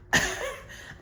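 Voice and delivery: airy, high burst of laughter; a quick unvoiced giggle of delight; breathy laugh. Real, not acted. Laughs while getting excited.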